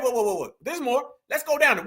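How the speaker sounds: normal talking voice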